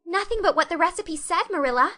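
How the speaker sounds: put-on high, loud girl's anguished plea; anguished cry. Anne protesting to Marilla in alarm, high anguished cry. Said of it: "cried Anne with a look of anguish".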